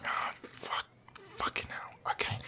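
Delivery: whispered curse under breath; whispered aside. Acted. Staff member cursing to himself, a whispered aside. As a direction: curse to yourself so he can't hear.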